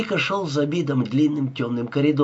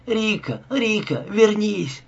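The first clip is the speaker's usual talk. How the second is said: desperate calling; 8 semitones higher, slower than usual. A narrator's reading. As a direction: call him back as he runs. Girl calling after fleeing man.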